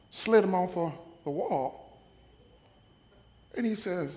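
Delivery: ordinary talk, nothing marked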